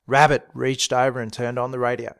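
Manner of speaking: ordinary delivery, nothing marked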